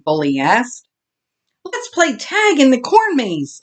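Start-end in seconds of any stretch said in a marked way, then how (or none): 1.7-3.6 high, eager call to the others; shouted. Acted. Olivia calling out to the animals, excited shout. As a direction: call out the game idea to the gang. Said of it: "Olivia shouted".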